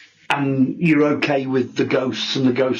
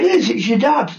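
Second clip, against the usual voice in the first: higher-pitched insistent retort, retold; mildly acted, adamant correction, pitched up; absolutely convinced; 10 semitones higher than usual. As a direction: imitate someone insisting he is right. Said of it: "Absolutely convinced".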